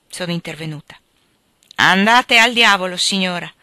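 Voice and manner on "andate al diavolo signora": loud, surprised snap; startled angry retort. A narrator's reading. Hattersley loudly tells the narrator to go to the devil. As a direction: throw it back at the bold woman. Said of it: "meravigliato della mia audacia".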